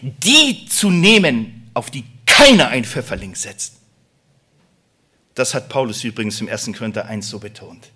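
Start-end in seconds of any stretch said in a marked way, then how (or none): 0.0-3.8 loud, high preaching build; impassioned preaching. Acted. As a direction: preach it out to the congregation. Preaching at full force.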